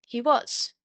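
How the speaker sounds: ordinary delivery, nothing marked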